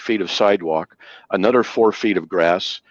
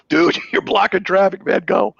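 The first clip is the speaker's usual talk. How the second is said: exasperated; 10 semitones higher than usual. Retold or imitated. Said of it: "and finally I go".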